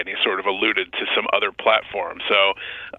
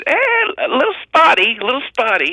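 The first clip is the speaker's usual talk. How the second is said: high, loud hammed-up Mad Dog impression; mock sports-radio whine; imitating Mad Dog; 4 dB louder than usual. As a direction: squeal it like a radio host shrugging it off. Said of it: "And Doug goes".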